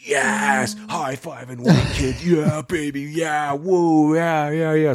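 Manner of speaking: mock celebration. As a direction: cheer like you just won the game. Celebrating pilot's firing.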